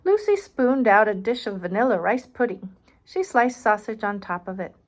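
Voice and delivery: put-on reading aloud to a child, clear and bright; storybook reading voice; story narration. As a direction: narrate the picture book. Reads picture book aloud.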